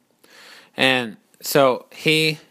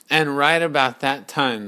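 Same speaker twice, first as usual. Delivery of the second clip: usual speaking voice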